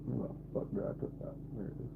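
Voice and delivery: whispered to self while searching; muttered whisper. Real, not acted. Streamer hunting for something, muttering to self. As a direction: mutter it under your breath.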